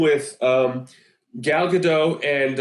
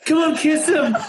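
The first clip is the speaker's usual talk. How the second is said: higher, louder egging on; mock cheering at the screen; imitating a loud girl egging them on; loud urging; 9 semitones higher than usual. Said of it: "Like, loud".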